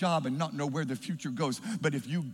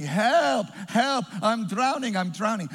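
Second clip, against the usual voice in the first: loud, higher drowning cry, easing off at the end; acted shout for help; 5 semitones higher, 5 dB louder than usual. Drowning man shouts for help to passers-by, acted shout. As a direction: call out for rescue, then let it drop a little.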